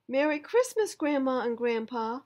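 put-on high, loud joyful girl greeting; girl welcoming grandparents; excited greeting. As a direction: call out happily at the door.

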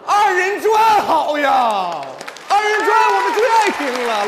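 hammed-up enthusiasm for a favourite show; excited cheering. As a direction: cheer like an eager audience member. Cheering on a promised song.

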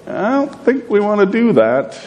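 high doubtful voice of the sister; skeptical mourner. Retold or imitated. The sister doubting Jesus, pitched high and reluctant. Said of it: "So she's like".

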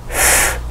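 "フ" as a long hiss of air, no vowel; voiceless, sustained "フ"; breath-only F. Shown as a demonstration. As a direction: blow "フ" out as a pure consonant. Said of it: "両方とも息の音".

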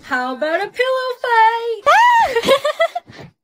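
high, loud, stretched-out squeal; excited. Acted. Girl at slumber party proposes a game, excited. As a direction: shout it gleefully, drawing out the words.

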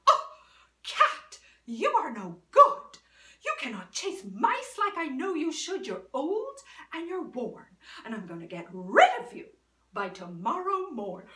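loud, mock threatening mistress; threatening the cat. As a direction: scold the old cat away.

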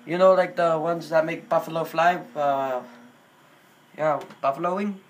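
put-on halting Indian-accented English. Prank caller asking a wings place for llama, in Indian accent.

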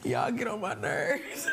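high laughter breaking through words; laughing. Real, not acted. Host cracking up at her own candy joke, laughing. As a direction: laugh helplessly, then slowly get the words out.

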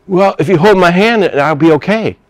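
lightly acted timid boy; imitated anxious kid. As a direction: ask like a child wanting reassurance. Retold kid asking to hold hands.

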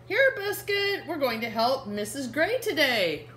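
put-on bright little-girl character read to kids. Reading Biscuit to children, the little girl cheerfully calls her puppy. Reading picture book aloud.

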